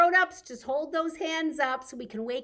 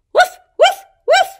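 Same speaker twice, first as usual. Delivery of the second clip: high, loud yappy woofs; playful loud puppy barks; dog bark; 20 dB louder than usual. Pretend.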